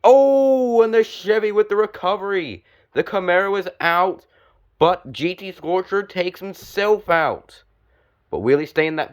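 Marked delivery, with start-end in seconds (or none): 0.0-7.6 excited outburst. Real, not acted. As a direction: shout the surprise, then keep the thrill going. Sudden crash during live commentary.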